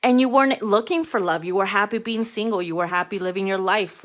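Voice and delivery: ordinary talk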